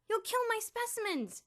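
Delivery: high panicked shout. A narrator's reading. Elmer shouting to stop Bruno from bathing, very high and loud. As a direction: yell to stop him. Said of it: "Elmer shouted".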